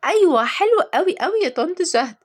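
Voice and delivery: put-on high-pitched child voice; squeaky happy kid; delighted little girl. A narrator's reading. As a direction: answer eagerly like a small child.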